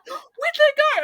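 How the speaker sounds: high, loud, startled "where'd that go"; panicked squeak. Retold or imitated. Retelling her slip on the keyboard, high panicked squeak. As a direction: playfully relive the moment of alarm. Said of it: "and I was like, and I'm like".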